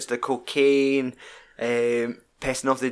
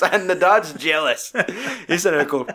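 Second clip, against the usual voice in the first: ordinary talk, nothing marked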